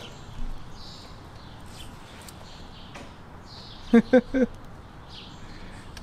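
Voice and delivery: giggling high and breathless; high breathy laugh. Real, not acted. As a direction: laugh at the dog. Laughing at dog.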